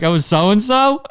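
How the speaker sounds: high, slow, feigned innocence; mock innocent. Comic answering the furious club owner, playing dumb. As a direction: stretch it out like you barely know him.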